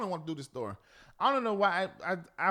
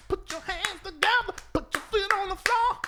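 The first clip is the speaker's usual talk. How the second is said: mock preacher; 9 semitones higher than usual. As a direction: call it out like a pastor.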